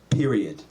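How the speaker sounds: "Period" said low with a final fall; emphatic spoken period. Shown as a demonstration. Comic says the punctuation aloud, emphatic. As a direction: drop "Period" down as a full stop. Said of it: "they're actually saying the period".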